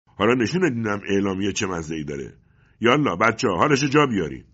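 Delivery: loud, high menacing shout; angry shout. A narrator's reading. Agent finds leaflets on father, shouts threats to his men. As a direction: yell threats and orders. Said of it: "فریاد زد".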